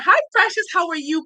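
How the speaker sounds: high-pitched, sweet hello; affectionate excited greeting. Real, not acted. Host greets a precious guest on the live, delighted. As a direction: greet them high, then ease down.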